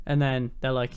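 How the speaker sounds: ordinary talk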